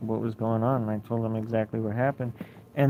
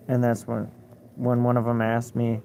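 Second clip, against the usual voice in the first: ordinary talk, nothing marked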